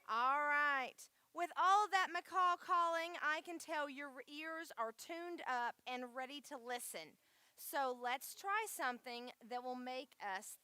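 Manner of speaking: loud, projected call to attention; calling to the crowd. Real, not acted. Host rallies kids to listen after macaw calls, calling out loudly.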